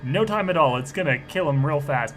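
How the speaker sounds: ordinary talk, nothing marked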